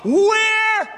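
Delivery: high, loud, drawn-out cry; fired-up preacher shouting. Real, not acted. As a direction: push the church to top the last one. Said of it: "Are y'all listening to somebody or what?".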